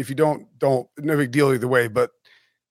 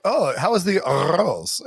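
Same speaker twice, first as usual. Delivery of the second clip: dad's put-on Spanish lilt, rolled R; slow it down and roll the R in arroz, Spanish-style; Spanish inflection; 3 semitones higher, slower than usual. Dad at a resort asking about rice, Spanish inflection. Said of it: "little Spanish inflection".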